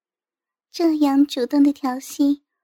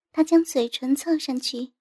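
plain reading voice

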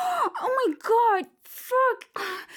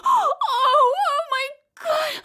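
distraught cry; 5 semitones higher, 4 dB louder, slower than usual. Acted. Distraught crying out.

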